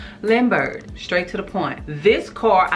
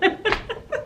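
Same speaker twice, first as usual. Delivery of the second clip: high breathy laugh; 14 semitones higher, 6 dB quieter than usual. Real, not acted. Laughing at own story.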